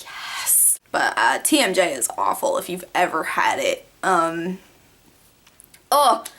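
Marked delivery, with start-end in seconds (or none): 0.0-0.8 hushed, breathy little yes; whispered victory. Retold or imitated. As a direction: whisper a quiet triumphant yes. Jaw fixed by chiropractor.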